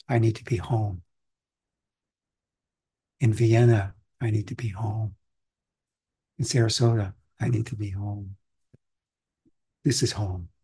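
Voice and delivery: ordinary talk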